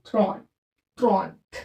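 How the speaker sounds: थ्रॉन्थ low and set apart, as French "tront"; French pronunciation. Shown as a demonstration. Teacher showing learners how thirty is pronounced, थ्रॉन्थ said low in French. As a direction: say थ्रॉन, थ्रॉन्थ as French trente, one take at a time. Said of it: "क्या pronounce करते हैं इसको?".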